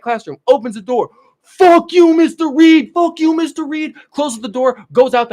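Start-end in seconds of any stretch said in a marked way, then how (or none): 1.4-3.9 loud, high screamed insult; cussing out. Retold or imitated. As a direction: bellow it into the classroom. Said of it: "and I will cuss him out".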